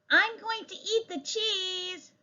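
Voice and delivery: put-on high child voice calling out. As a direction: call it out like an unshushable kid. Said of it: "called out the child".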